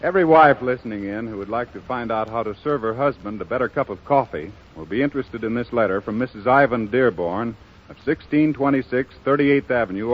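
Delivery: ordinary delivery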